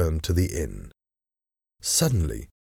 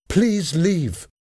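high-pitched, mildly raised cry; shouted; 11 semitones higher than usual. A narrator's reading. Shocked lady ordering the envoy out, shouting mildly. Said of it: "she shouted".

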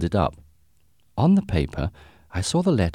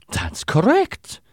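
put-on slow, high German-accented reply; strong German accent; 12 semitones higher, slower than usual. A narrator's reading. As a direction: confirm it slowly in a thick German accent. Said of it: "He had a strong German accent".

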